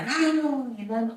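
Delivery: calling a name from far off, high; shouting a name. Retold or imitated. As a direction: call out the name across the house. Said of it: "y grita". (gritando el nombre, agudo)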